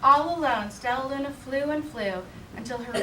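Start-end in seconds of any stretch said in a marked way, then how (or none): none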